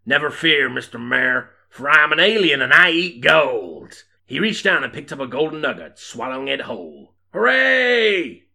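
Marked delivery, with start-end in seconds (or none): 0.1-4.0 loud, raised, grand hero voice; hammed-up gunslinger bravado; heroic proclamation. Hero's proclamation in the story. 7.3-8.4 loud, high hooray; high-pitched crowd celebration; bellowed cheer. Acted. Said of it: "bellowed the townsfolk".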